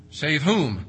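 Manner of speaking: ordinary talk, nothing marked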